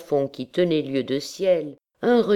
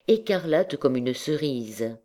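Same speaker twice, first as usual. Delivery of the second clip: plain reading voice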